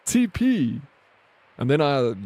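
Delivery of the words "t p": high, louder mock disbelief, voice jumping up; acting out his stunned reply to the friend; incredulous outburst. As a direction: retell your stunned reaction, pitched way up. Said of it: "I'm like".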